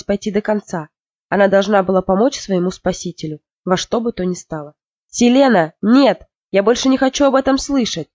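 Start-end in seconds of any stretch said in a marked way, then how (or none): 5.1-8.1 irritated, loud, higher-pitched outburst; irritated exclamation. A narrator's reading. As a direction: snap at the daughter, fed up. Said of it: "раздражённо воскликнул Джорел". (раздражённый громкий возглас отца)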